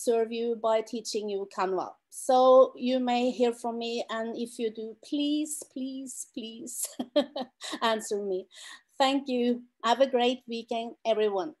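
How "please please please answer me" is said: begging, fading softer each time; plead, trailing away to almost nothing; playful pleading. Real, not acted. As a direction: mock-desperate please, dying away. Begging people to answer her.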